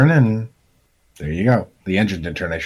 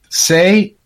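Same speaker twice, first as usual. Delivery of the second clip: ordinary delivery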